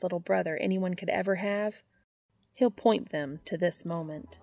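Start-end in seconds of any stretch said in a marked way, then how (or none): none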